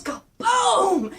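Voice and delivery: loud, drawn-out boom; imitated explosion. Describing the portal blowing up, loud mock explosion. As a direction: burst out with it.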